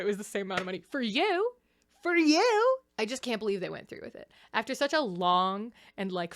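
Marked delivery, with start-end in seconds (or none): 0.9-1.5 high, rising jab at the actor; mock-indignant comeback, pitched up; pointed retort. Acted retort to actor's cost claim. 2.0-2.8 very high, loud rising jab; mock-outraged comeback, loud and squeaky-high; indignant retort. Acted retort, repeated harder.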